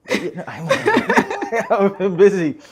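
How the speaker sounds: giggling through the words; laughing. Real, not acted. Guest laughs about being busy.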